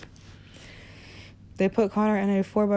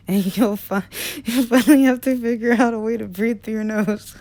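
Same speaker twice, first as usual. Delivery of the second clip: words breaking into real laughter; losing it mid-line, laughing through the threat; cracking up laughing; 4 semitones higher, 7 dB louder than usual. Real, not acted. Narrator reading a threat to a whiny boy, breaking into real laughter. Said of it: "Okay, sorry".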